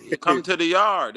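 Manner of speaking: hamming up a Jamaican herbalist calling you to his yard; mock Jamaican accent. To his partner, hammed-up Jamaican accent inviting people over. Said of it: "all they know is".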